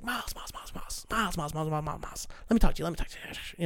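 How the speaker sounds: hushed, rapid-fire pestering imitation; crackhead energy. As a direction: imitate someone frantically pestering, under the breath. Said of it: "that big crackhead energy".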